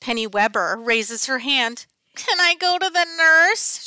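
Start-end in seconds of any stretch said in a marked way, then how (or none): 2.2-3.8 high, whining plea; a girl whines to the teacher, higher and louder; whiny kid. A narrator's reading. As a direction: whine to get out of class. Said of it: "she whines".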